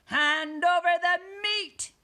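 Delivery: loud, high, drawn-out demand; pirate demand. A narrator's reading. Captain demands food from crew, loud pirate call. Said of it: "gulped his food and said".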